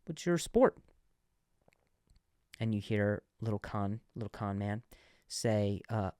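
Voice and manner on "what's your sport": put-on old man voice. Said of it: "the guy says".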